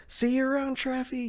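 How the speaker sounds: put-on high-pitched little kid voice. Chopper waving bye to Traffy, high kid tone. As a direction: pitch it way up like a child. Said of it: "in his normal kid tone".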